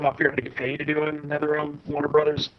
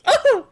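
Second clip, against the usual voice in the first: very high, loud mock shriek; sudden high-pitched imitation scream; scared yelp; 5 dB louder than usual. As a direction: squeal like a frightened kid breaking character. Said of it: "he actually gets scared and breaks character".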